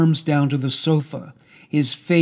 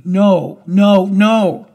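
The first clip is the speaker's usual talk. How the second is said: loud, higher cries of refusal; raised, building shouts of denial; screaming; 4 semitones higher, 7 dB louder, much slower than usual. A narrator's reading. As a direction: scream it in desperate protest. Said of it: "I was screaming".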